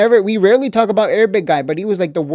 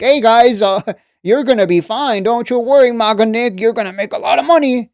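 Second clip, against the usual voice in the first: louder, higher mock cheery comfort; mock fake reassurance; 4 semitones higher, 6 dB louder than usual. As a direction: ham up a fake soothing coach. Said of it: "when do I talk like that?".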